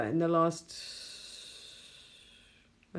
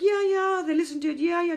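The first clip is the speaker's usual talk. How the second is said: high, airy brush-off; sing-song yeah yeah impression; dismissive mock; 12 semitones higher, 5 dB louder than usual. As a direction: mimic someone waving it away.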